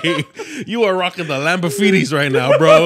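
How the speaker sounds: loud, high laughing protest. Retold or imitated. Laughing at a friend's rap bar, loud and high. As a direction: push the words out through laughter, loud and high. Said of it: "And I was like".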